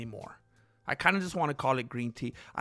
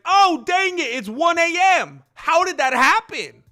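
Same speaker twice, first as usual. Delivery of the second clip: loud, high-pitched alarmed cry; mock panic yell; 12 semitones higher, 10 dB louder than usual. Streamer acting out noticing it's one A M, mock panicked yell.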